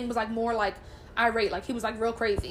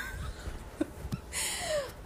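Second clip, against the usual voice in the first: high wry laugh; 10 dB quieter than usual. Real, not acted. Laugh after grim story.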